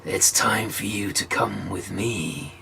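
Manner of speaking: low, slow and menacing devil. Acted. Devil summoning Wicked John, low and menacing. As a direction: drag each word out like a devil. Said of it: "the devil looked at Wicked John and he says".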